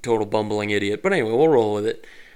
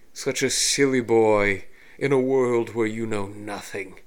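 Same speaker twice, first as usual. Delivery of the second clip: slow smug villain; slower than usual. Acted. Villain taunts.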